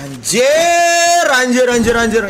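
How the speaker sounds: long, high cry tumbling into repeats; real high-pitched astonishment at the play; amazed outburst. Real, not acted. (seruan kaget penuh takjub)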